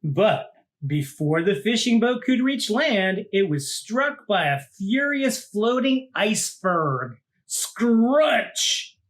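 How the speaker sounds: put-on lively read-aloud for children; story narration. A narrator's reading. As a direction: tell it like a picture book to a class. Reads picture book aloud.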